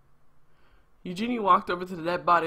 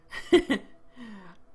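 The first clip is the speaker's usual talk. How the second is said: short burst of laughter; real laugh; 5 semitones higher, 3 dB louder than usual. Real, not acted. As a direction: laugh out loud. Player laughs at the beer line.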